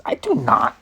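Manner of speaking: squeaky outraged protest; high indignant denial. Acted.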